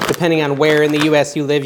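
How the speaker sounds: ordinary delivery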